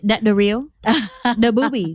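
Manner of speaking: imitated Vietnamese accent, drawing out the question; Vietnamese nail tech accent. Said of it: "accent, this character".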